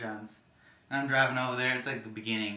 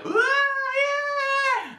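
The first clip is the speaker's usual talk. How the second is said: very high, loud party scream; imitated girls' screaming; 10 dB louder than usual. Girls in a pink limo cheering, imitated high loud shriek.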